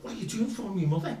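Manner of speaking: high-pitched mock protest; retold confrontation. Acted exchange with new wife.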